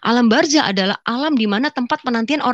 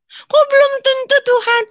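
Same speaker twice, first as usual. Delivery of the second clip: much higher and louder, startled question; surprised protest; 5 dB louder than usual. Retold or imitated. The dreamer protesting to God, surprised. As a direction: object in disbelief.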